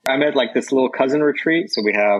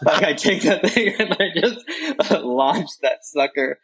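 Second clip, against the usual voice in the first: laughing through the words, pitched high; giggling as he tells it; 7 semitones higher than usual. Real, not acted. Telling friends how he tossed the snake, laughing.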